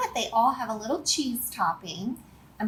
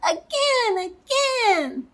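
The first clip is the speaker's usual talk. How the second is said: put-on high-pitched little kid's delighted cry; excited scream; 12 semitones higher, slower than usual. Little Pea flung off a spoon into the air, screaming high. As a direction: cry out high and gleeful, wanting more. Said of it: "Little Pea would scream".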